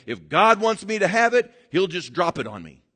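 high, loud demanding mock prayer; mock lazy prayer. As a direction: beg lazily, expecting a handout. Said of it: "They'll say".

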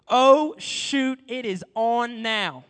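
loud, drawn-out "oh, shoot, it is on now"; thrilled exclaim. Real, not acted. Preacher hyping the showdown to the congregation, excited and loud. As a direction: shout it, then slow right down.

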